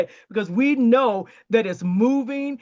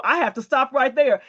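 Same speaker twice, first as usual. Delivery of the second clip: normal talking voice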